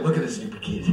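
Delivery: low, gruff mock mother; mom's deep voice. Comic mimics his mother mocking her kid, low. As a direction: sneer it like a deep-voiced mom. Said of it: "that's how my mom talks. She got a really deep voice".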